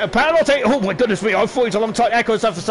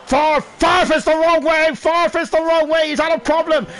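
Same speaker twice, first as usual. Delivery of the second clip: excited call; 6 semitones higher than usual. Real, not acted. Commentator spotting a car facing the wrong way, alarmed. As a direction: shout it as the car faces backwards.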